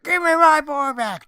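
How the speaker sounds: very high, loud, slow anguished yell; trailer scream. Pretend. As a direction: scream it like the climax of a movie trailer. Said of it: "right at the end of the trailer".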